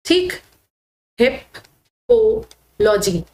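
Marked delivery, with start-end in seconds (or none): 1.2-3.2 हिपोलॉजी said very slowly in three parts, हिप, पो, logy; teacher sounding out हिपोलॉजी chunk by chunk; broken into parts. Shown as a demonstration. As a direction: split हिपोलॉजी with long pauses after हिप and पो. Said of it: "इस तरह से करोगे कि हिप".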